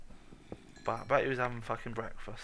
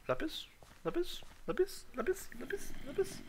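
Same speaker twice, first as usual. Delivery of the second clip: calling the ore in a very high rising voice; sing-song calling; 4 dB quieter than usual. Pretend. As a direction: call out as if for a lost pet.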